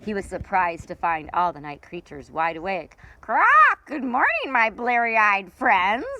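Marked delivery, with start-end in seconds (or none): 3.2-3.5 one short raspy parrot squawk; parrot croak. Acted. Parrot croaks before greeting. 3.9-6.1 higher, louder, slow jolly parrot greeting; cheerful parrot. Acted. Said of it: "Papagayo laughed".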